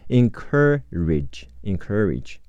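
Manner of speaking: American "encourage" with an r-coloured in-KER-ridge; American pronunciation. Shown as a demonstration. Teacher shows learners the American variant, encourage as in-KER-ridge. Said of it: "美式发音是科呃科".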